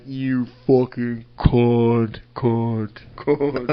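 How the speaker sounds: low, long-drawn curse with imitated echo; echoing insult. Retelling a curse at the ticket lady, drawn-out echo. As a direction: stretch each word out slowly, as if it echoes. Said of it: "And it just echoed".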